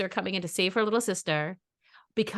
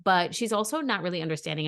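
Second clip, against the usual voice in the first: ordinary delivery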